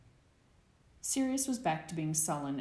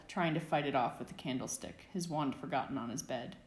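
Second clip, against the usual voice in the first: ordinary delivery, nothing marked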